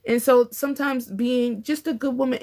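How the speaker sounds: ordinary talk, nothing marked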